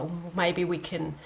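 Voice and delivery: ordinary delivery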